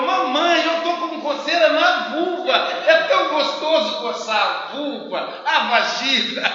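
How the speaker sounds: put-on loud, high kid bursting into the room; excited child announcement. Little girl bursting in to tell her mother, loud and thrilled. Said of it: "ele invadiu a sala".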